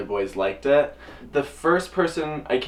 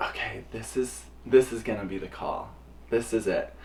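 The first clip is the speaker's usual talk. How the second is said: bracing myself; 7 dB quieter than usual. Retold or imitated. Bracing self before call.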